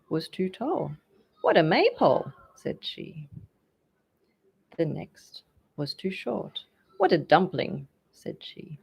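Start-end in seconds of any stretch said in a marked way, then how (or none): 1.4-2.3 loud, higher scornful jeer; mock the tall suitor; spiteful mocking. A narrator's reading. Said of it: "something spiteful to say". 7.0-7.9 louder scornful quip; spiteful mocking. A narrator's reading. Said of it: "something spiteful to say".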